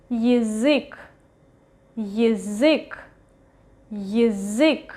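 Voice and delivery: язык with я reduced to a short yi, stress on the last syllable; reduced initial Ya. Shown as a demonstration. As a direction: say язык as yi-ZYK, carefully modelled. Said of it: "we read it as Y".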